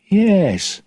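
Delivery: a touch of nerves, high voice; nervous. A narrator's reading. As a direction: answer uneasily, pitched high. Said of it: "Jack said nervously".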